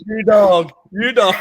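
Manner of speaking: loud mock scolding, grinning; playful shout. Real, not acted. Teasing his friend for talking dirt, loud mock scold. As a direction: bark it out laughing at your mate.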